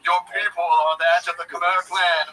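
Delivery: mock-grand, slow ancestral papa; sky voice from The Lion King. Papa proclaiming the clan to his child, mock-solemn. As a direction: proclaim it like a father in the clouds. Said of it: "sounds like the sky from the Lion King".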